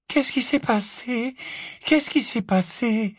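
slow, high airy questioning; dreamy girl voice. Acted. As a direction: ask it slowly, far away. Luna asks, dreamy girl voice.

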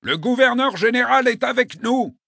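high, loud call across the distance; shouting. Acted. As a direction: call out to the soldiers. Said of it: "leur cria le consul".

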